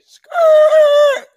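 high, loud drawn-out screech; high-pitched skid sound effect; imitated tire screech. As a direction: mimic tires skidding, long and high. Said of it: "I just hear".

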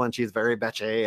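ordinary talk

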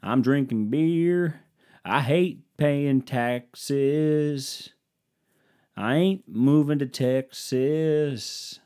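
slow Southern country drawl, sung-spoken; mock Southern country crooner; southern twang. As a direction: croon it like a lazy country singer. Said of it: "get a little southern twang to my voice".

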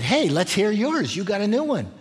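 high, eager, slowed "let's hear yours"; a lifted, curious challenge from the Greeks; eager Athenian crowd. Retold or imitated. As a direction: voice the curious crowd egging him on. Athenians voiced inviting Paul.

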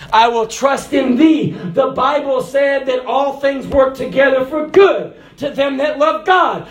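rising, loud preacher's cry; climbing to a fervent shout; full-force preaching. Acted.

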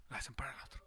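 breathy whisper, barely voiced. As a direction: drop to a whisper as if sharing a secret. Shows turning a ship's rudder.

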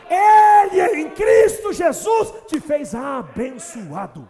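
loud, high, slow proclamation; preaching at full force. Acted. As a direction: thunder it out over the church. Preaching climax. (pregando com toda força, alto e lento)

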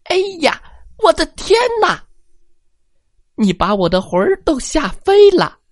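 high, fast squeaky granny shriek; an old woman scared out of her wits; tiny old lady crying out in fright; frightened old granny. Acted. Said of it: "喊了起来".

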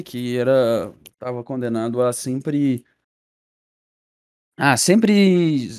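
normal talking voice

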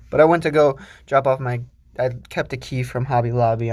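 normal talking voice

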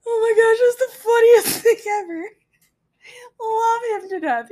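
high, loud, breaking into laughter; can barely get it out for laughing; laughing hard. Real, not acted. Telling viewers the funniest story, laughing loudly. Teller cracking up at memory.

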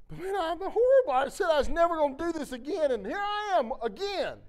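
hammed-up retelling of his own protest; mock distraught protest.

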